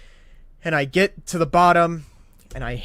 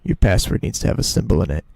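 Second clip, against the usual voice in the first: robotic website voice; 8 semitones lower, 6 dB louder, faster than usual. Retold or imitated. Site error message, third time.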